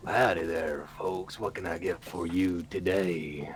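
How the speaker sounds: put-on low, drawling lizard shopkeeper with an accent; a lightly voiced folksy lizard merchant; lizard person voice. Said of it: "I wanna hear you do a lizard person voice with, the, with an accent".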